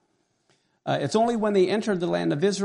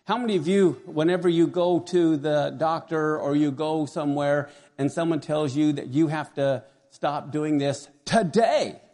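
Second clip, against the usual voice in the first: ordinary talk, nothing marked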